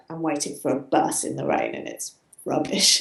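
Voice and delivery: low, soft, dreary Briton grumbling; glum British moaning. Retold or imitated. Reading a British friend's status, low and glum. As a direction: sigh it out like a fed-up Brit. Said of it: "the British ones would be like".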